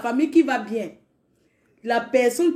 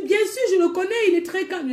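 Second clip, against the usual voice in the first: higher, confident lady; quoting the lady, much higher; vouching neighbour; 8 semitones higher than usual. Retold or imitated. Neighbour vouching for brother to the sister, higher.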